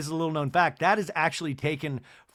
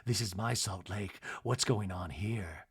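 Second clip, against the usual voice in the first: worried mutter; 6 semitones lower, 9 dB quieter than usual. Retold or imitated. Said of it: "Heather's worried look".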